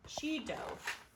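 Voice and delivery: plain reading voice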